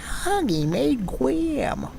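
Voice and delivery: very high, slow sneering imp; impish voiceover guy. Pretend. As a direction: purr the brand name like an imp. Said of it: "I'm an impish voiceover guy".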